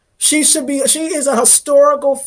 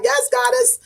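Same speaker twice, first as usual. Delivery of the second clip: eager fan reply; 12 semitones higher than usual. Retold or imitated. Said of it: "Be like".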